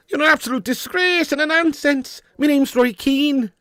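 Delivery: very high, mock Irish accent; Roy Keane impression. Streamer doing a viewer's requested accent, high-pitched mock Irish telling-off. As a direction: scold in a squeaky, hammed-up Irish voice. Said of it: "Can you do the Roy Keane accent?".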